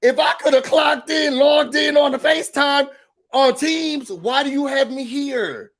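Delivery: indignant worker venting at the boss; mock angry office rant. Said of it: "Have you never been like".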